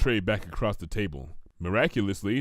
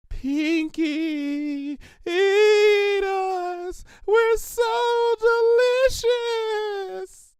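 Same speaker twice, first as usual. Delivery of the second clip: voice of the sweets; 4 dB louder, much slower than usual. Pretend. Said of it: "They call out to me".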